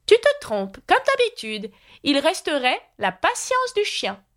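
high-pitched, know-all queen; contradicting Red Queen. A narrator's reading. Red Queen telling Alice she is wrong, high scolding voice. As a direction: correct her in a very high voice.

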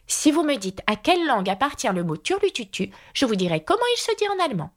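building to a quick, high finish; triumphant. A narrator's reading. Alice bargaining with the Red Queen, rising triumphant tone. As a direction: start measured, then race up in triumph. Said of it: "d'un ton de triomphe".

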